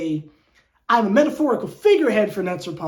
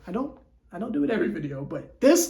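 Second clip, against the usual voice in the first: ordinary talk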